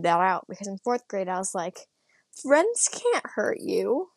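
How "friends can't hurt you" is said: drawn-out, higher-pitched naive claim; slow, lifted mock innocence; naive younger self. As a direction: stretch it out high, mocking your younger self. Acting out naive fourth-grade self.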